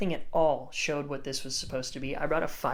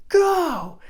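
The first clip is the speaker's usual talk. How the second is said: high, loud coaching yell; urgent shout; 12 dB louder than usual. Retold or imitated. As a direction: send a surfer onto the wave with a shout. Retells calling surfer into wave.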